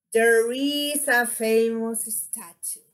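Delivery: measured model with a falling end on "statue"; statement intonation. Shown as a demonstration. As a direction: demonstrate a statement by dropping on "statue". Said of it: "let me see what I do".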